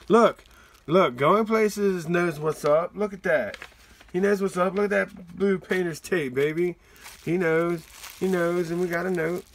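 loud, high and thrilled; hyped-up showing off; excited. Real, not acted. Excited at the painter's tape.

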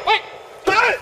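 loud sharp yell; shout. Real, not acted. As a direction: call out loudly. Shouting to get attention.

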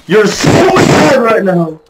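very high, loud jeering yell; slow, piercing mocking shout; gloating taunt. Real, not acted. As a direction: shriek it at the loser, stretching SO. Taunting a beaten opponent.

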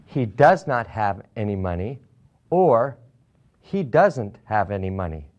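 ordinary talk

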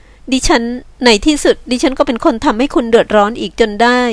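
hesitate, then own up regretfully; apologetic woman. Acted. Woman regrets troubling her rescuer, apologetic.

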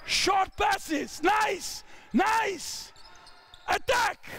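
high-pitched courtside cheer; calling out. Real, not acted. Coach praising short passes mid-drill, calling out. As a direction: call encouragement out to players.

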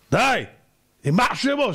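sudden high, loud snap; acted husband losing patience; exasperated outburst. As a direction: cut her off angrily. Husband snaps at questioning wife.

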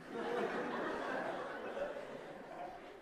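a short airy laugh at himself; breathy laugh. Real, not acted. Preacher laughing at his own daydream, breathy laugh. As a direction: laugh off the odd confession.

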